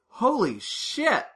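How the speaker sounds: high, loud mock amazement; hammed-up astonished onlookers; amazed crowd. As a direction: gasp at the trick, pitched high. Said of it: "they're like".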